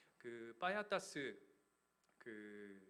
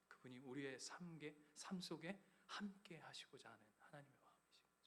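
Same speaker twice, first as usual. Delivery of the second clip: hushed whisper; 10 dB quieter than usual. Acted. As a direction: drop to a soft whisper. Sermon drops to intimate tone.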